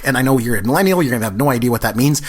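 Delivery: ordinary delivery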